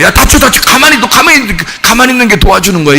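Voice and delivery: quick, anxious warning, words tumbling; fast fretful caution; urgent fussing. Retold or imitated. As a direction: rush to stop someone getting hurt. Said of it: "이런 사람이 있잖아요".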